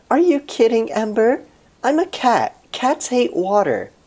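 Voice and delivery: high, bright-eyed cat character. Acted. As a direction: squeak it out like an indignant cat.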